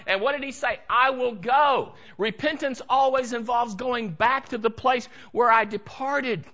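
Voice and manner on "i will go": slow, louder, every word planted; firm resolve. Acted. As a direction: declare it slowly and firmly. Quoting prodigal's resolve.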